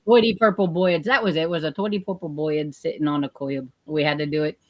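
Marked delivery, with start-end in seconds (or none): 0.0-1.0 put-on higher, louder old-style Jersey accent, "boyeds" for birds. Shown as a demonstration. Performing music class drill, broad Jersey accent. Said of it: "Jersey style". 1.7-3.7 put-on broad Jersey vowels, "boyeds" for birds; Jersey accent. Shown as a demonstration. Repeating music class line, imitated Jersey accent. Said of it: "Jersey style".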